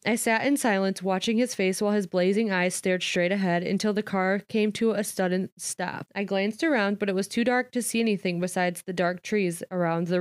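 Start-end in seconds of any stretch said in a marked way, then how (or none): none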